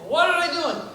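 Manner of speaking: high, loud exasperated outburst; frustrated caller. Acted. Caller demands what the family is doing, exasperated. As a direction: burst out, out of all patience. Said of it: "The man's getting frustrated".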